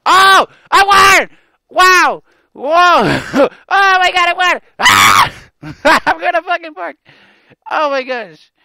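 loud, very high joyful shrieks; shrieking jackpot winner. Real, not acted. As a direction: scream and laugh with real joy. Winning the jackpot.

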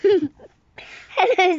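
giggly greeting; friendly hello. Acted. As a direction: burst in laughing and friendly. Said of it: "said a friendly face".

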